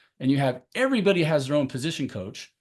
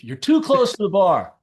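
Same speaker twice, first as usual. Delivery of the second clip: louder, higher coaching call; 5 semitones higher, 7 dB louder, slower than usual. Pretend. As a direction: call the fix from the sideline. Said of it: "I would be like going".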